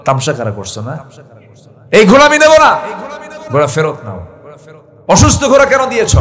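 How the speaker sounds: storyteller's heated complaint; retold anger. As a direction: imitate an angry buyer refusing the goods. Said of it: "উনি রাগ করে গিয়ে ঘোড়াওয়ালাকে বললেন".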